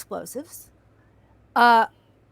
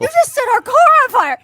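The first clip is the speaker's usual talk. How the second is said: high, loud, fast outraged yelp; shocked outcry; 11 semitones higher, 11 dB louder, faster than usual. Retold or imitated. Stuntman yelling at crew who lit his car. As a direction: blurt it out in disbelief.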